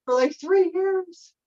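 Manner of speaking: mock-weeping about a heart that won't open; mock crying whine. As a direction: whine it like a self-pitying sob, mocking. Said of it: "Or you could be sitting there crying".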